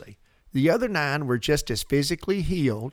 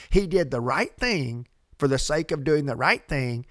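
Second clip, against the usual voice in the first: ordinary talk, nothing marked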